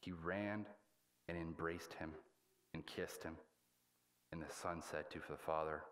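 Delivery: ordinary talk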